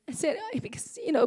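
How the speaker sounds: ordinary talk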